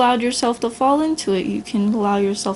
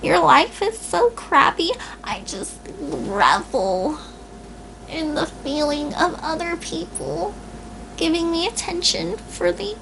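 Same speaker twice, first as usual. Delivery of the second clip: mocking whiny complainer; 7 semitones higher than usual. Speaker lampooning a self-pitying complainer, high mock whine. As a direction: sneer in a high pitiful voice.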